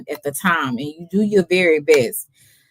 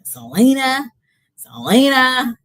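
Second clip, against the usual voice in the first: drawn-out plaintive calling of a name; mock whiny call; 7 semitones higher, slower than usual. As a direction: stretch the name out, hurt and pleading. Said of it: "You was like".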